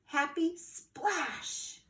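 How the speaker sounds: very slow, stretched words; drawn-out splash. A narrator's reading. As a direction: stretch it like a big leap. Narrator draws out splash.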